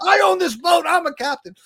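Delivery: loud, high protest, played for laughs; mock indignant retort. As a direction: protest loudly in mock outrage. Acts out retort to friend.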